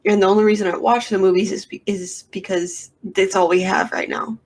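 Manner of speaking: normal talking voice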